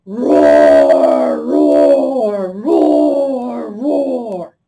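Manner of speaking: big playful lion roar. Shown as a demonstration. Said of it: "Get out your lion voice with a roar".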